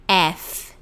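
"F" with stressed eh and a soft f; accented E, soft F. Shown as a demonstration. Teacher modelling English F, stressed start and gentle ending. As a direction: lean on the vowel of "F", let the f fade. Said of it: "E fica bem ali acentuado".

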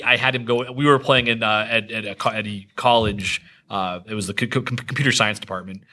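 normal talking voice

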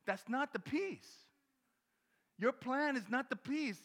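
slow, high, loud declaration; God's voice. Retold or imitated. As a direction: deliver God's firm verdict slowly. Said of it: "God is, like, looking at us going".

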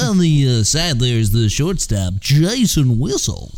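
slow stadium announcer call; baseball announcer. Shown as a demonstration. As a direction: introduce the shortstop to the crowd. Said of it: "old-timey baseball sportscaster".

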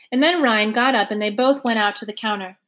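ordinary delivery, nothing marked

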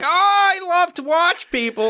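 high, squealing comic confession; mock creepy voyeur. Host joking he's a voyeur of his videos, hammed-up delight. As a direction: gush it in a silly high voice.

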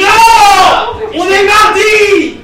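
high, loud, slow shout. Acted. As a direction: bellow it slowly. Bandit refuses the goblin.

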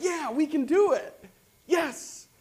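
high, loud burst of pumped-up joy; excited cheer. Retold or imitated. Speaker replaying his own reaction to Scripture, pumped and cheering. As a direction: cheer yourself on like you just got good news. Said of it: "I'm really excited".

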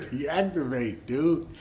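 slow, drawn-out robot order; robotic command. Retold or imitated. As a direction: say it like a programmed machine, syllable by syllable. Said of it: "Like he was a robot".

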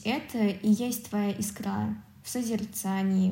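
ordinary talk, nothing marked

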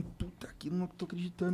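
voiceless, hushed whisper of disbelief; stunned whisper. Retold or imitated. New father reacting to news of his son, stunned whisper. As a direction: whisper it under your breath, stunned. Retold stunned words to himself.

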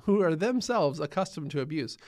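ordinary talk, nothing marked

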